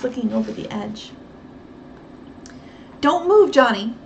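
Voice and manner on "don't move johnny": louder urgent call. Acted. Nancy calling to the boy to freeze, loud. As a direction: call across the yard to freeze him. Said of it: "Nancy called".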